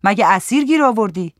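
loud call across the yard; shout. Acted. Woman yelling at the man dragging her. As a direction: yell to stop him. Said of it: "داد زد".

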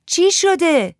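high, loud called question; calling back. A narrator's reading. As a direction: call the question across to a child. Aunt answers child's shout. (بلند پرسیدن از دور)

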